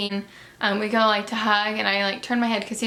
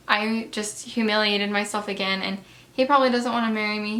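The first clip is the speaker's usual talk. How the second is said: ordinary talk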